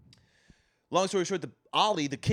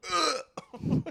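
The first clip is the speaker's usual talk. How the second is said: high-pitched "ugh" of revulsion; retold disgusted groan; 12 semitones higher than usual. Re-enacts reaction to gory scene.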